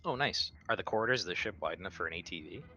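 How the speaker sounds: ordinary talk, nothing marked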